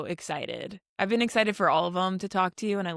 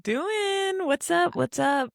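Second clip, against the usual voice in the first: high squeaky greeting; 11 semitones higher, much slower than usual. Acted. Playful exaggerated greeting.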